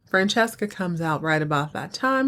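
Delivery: ordinary talk, nothing marked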